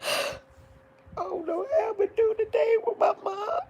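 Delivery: real high, sobbing cry; breaking down over her mom; sobbing, pitched very high. Real, not acted. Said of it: "when you're crying and stuff".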